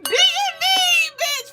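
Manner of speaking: very high, laughing, crying-out squeals; gushing squeal. Real, not acted. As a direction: squeal your love like an overjoyed fan. Gushing at a viewer on stream.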